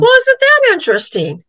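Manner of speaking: impressed exclamation. Retold or imitated. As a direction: play the baffled friend, voice jumping up high. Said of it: "and he goes".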